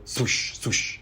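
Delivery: two soft unvoiced whooshes; arrow swooshes. Shown as a demonstration. Proud of nailing the arrow sound, soft swooshes. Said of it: "I think I nailed that sound".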